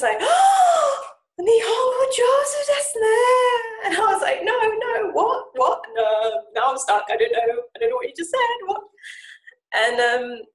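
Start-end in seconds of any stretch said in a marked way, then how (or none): 0.2-3.9 much higher, louder, slow delighted squeal; excited squeal. Retold or imitated. Japanese girl squealing with delight at her Japanese. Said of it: "she was so excited". 4.4-8.8 mock panic. Panicked reply, stuck.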